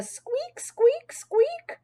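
high little squeaks; high-pitched rodent squeak; squeaky hamster. A narrator's reading. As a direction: squeak each word like a tiny pet. Narrator imitates hamster squeaks.

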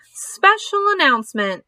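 high, ringing pompous announcement; pompous fairground announcer voice; pompous loudspeaker announcer. Acted. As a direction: announce it with great self-importance. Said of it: "in a pompous voice".

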